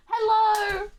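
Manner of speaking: high, playful calling out; mock call for help. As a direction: cry out in comic distress.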